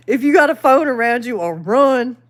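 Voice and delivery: ordinary talk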